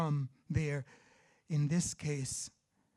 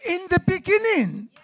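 raised, high preacher's voice; animated pulpit delivery, louder and higher; forceful preaching; 11 semitones higher, 15 dB louder than usual. Acted. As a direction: preach it with punch, phrase after phrase.

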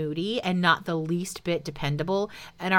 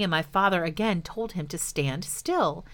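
usual speaking voice

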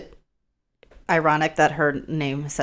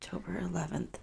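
whispering; 17 dB quieter than usual. Real, not acted. Echoing date to herself.

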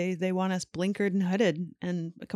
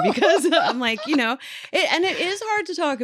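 higher, laughing through the words; 10 semitones higher, 5 dB louder than usual. Real, not acted. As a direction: talk while laughing. Laughs while talking.

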